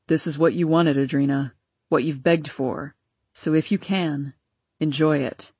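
low, grudging fatherly farewell; a hint of a resentful father; stern father voice. A narrator's reading.

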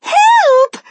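very high, loud scream; screaming for help. A narrator's reading. Missus Jenkins terrified of mouse, screaming for help. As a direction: scream it out in panic. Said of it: "screamed Missus Jenkins".

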